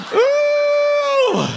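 high shouted ooh; excited shout. Real, not acted. Preacher whooping at the crowd. Excited exclamation after laughing.

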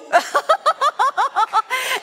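high, rolling burst of laughter; delighted laugh. Real, not acted. Laughs telling the story.

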